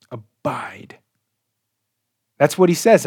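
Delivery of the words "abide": whisper. Acted. Quiet pause on the key word.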